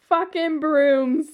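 loud, slow, laughing exclamation; laughing disbelief. Real, not acted. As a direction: stretch it out through laughter.

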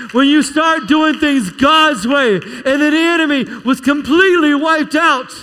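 high, loud preacher climaxing; full-force preaching. Real, not acted. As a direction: proclaim the victory to the congregation.

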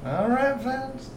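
high southern drawl; southern accent. Acted. Magistrate addressing one of the adventurers, southern accent. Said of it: "Stop doing the southern accent".

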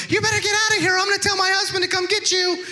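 very high-pitched mock threat; high-voiced man warning off a burglar, hammed up; Bobby. Comedian at top of stairs warning intruder, imitated high voice. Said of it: "he talks like this".

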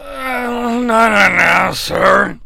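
slow, slurred groan; Shawn Michaels. Acted. Wrestler objecting to being called announcer, effortful slur. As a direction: force the words out in one drunken roll. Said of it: "it sounds like it took so much effort".

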